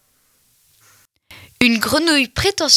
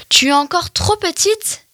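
sneering; 7 semitones higher than usual. Acted. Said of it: "ricanèrent ses compagnes".

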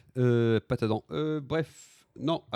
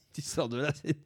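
usual speaking voice

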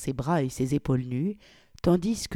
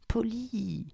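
soft, higher pleading voice; pleading low; 7 semitones higher, 5 dB quieter than usual. Acted. Victoria quietly pleads with Polly, soft and higher. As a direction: plead quietly, under the breath. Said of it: "plaida-t-elle à voix basse".

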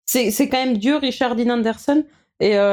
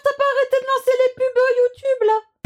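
high whiny teasing. Real, not acted. Teasing complaint about ads.

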